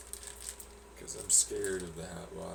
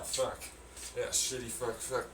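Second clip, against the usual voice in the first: ordinary delivery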